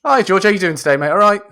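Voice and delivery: chirpy acted hello; mock phone greeting. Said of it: "You do an example".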